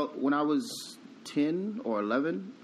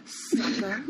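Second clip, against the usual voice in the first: gloating taunt; 5 semitones higher, much slower than usual. Pretend. Taunting cousin as kid.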